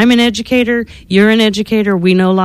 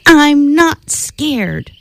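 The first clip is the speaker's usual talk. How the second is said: high, slow stubborn insistence; defiant kid; 7 semitones higher, much slower than usual. Acted. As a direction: say it slowly, higher, trying to be brave. Baby mummy insists.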